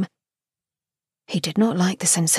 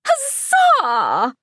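high, cheering Huzzah; excited cheer; 12 semitones higher than usual. A narrator's reading. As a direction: cheer it out like a delighted hostess. Missus York bursts into applause.

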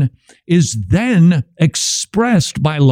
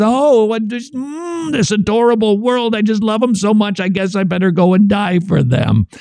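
mock sentimental god; 6 semitones higher than usual. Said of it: "It's not this".

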